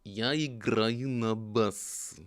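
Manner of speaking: Я играю на бас, slow and careful, each syllable spelled out; Russian attempt. Shown as a demonstration. Trying to say I play bass in Russian. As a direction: sound out Я играю на бас slowly. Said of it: "in Russian".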